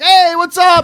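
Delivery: friendly greeting. Retold or imitated. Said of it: "very, like".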